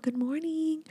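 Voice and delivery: soft, high singsong; gentle wake-up. Retold or imitated. Mother opening the windows for her daughter, gentle singsong. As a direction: coo it softly, as to a sleeping child.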